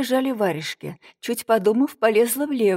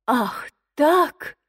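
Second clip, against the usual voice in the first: offended gasp; 5 semitones higher than usual. A narrator's reading. Offended outburst.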